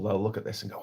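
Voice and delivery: ordinary talk, nothing marked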